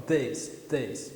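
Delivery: "This" with the tongue touching the palate, softly; "This" said quietly, with the tongue tapping the roof of the mouth; touched th. Shown as a demonstration. Teacher repeats the touched th, soft and low. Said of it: "eu sinto o toque".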